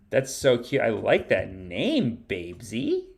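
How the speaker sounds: ordinary talk, nothing marked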